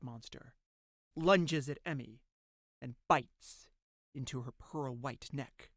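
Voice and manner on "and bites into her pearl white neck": plain reading voice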